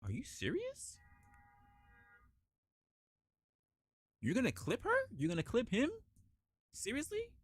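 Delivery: high, incredulous questions; acted disbelief. Onlooker seeing innocent people get clipped, incredulous. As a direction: let each question leap up in disbelief.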